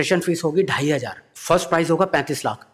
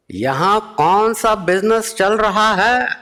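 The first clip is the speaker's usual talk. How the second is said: very slow, higher, louder orator voice; Modi impression; 8 semitones higher, 4 dB louder, much slower than usual. Modi asking which business runs here. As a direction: ask it like a grave public speech. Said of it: "Modi जी पूछते हैं".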